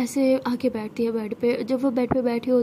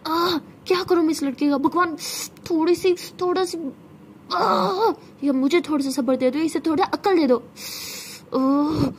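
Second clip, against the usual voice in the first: frustrated shouting; 5 semitones higher than usual. Acted. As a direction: shout at the heavens, near tears with frustration. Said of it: "ज़ोर से चिल्ला रही होती है".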